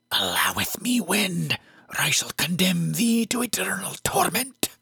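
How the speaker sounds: high, whispered, inhuman hiss. A narrator's reading. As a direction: hiss it like a possessed girl. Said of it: "she hissed at me in a voice that was anything but human".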